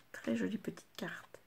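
soft whispered admiration. Real, not acted. Crafter opens a card, whispering to viewers. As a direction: breathe it out in a whisper.

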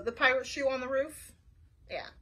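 ordinary delivery, nothing marked

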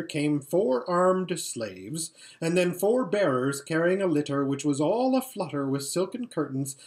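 usual speaking voice

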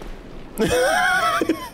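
real high shrieking laughter. Real, not acted. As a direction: laugh hard at the sudden drop. Laughing at the cliff.

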